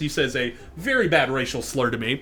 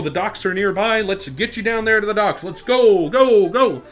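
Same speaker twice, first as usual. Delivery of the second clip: raised, higher teacher's call rallying a group; urgent herding; 5 semitones higher, 6 dB louder than usual. Retold or imitated. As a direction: call out to hurry everyone along. Acted teachers hurrying the kids off.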